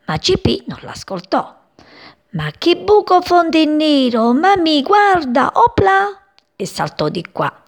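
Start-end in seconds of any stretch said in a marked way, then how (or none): 2.3-6.3 high, eager little bird voice; high chirpy chick, louder on opla; excited chick. Acted. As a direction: show mummy the hole, thrilled. Chick voiced in children's story.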